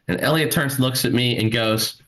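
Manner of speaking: ordinary delivery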